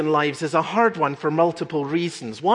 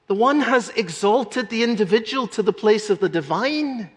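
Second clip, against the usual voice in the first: ordinary talk, nothing marked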